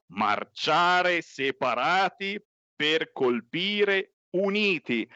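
each word drawn out; very slow, emphatic slogan. Acted. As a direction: spell out the slogan slowly. Slogan repeated even slower.

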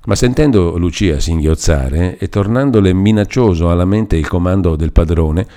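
ordinary delivery

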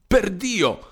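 high, explosive curse; exasperated oath. A narrator's reading. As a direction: blurt it out in fury. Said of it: "tornò a dire il commendatore agitando le carte".

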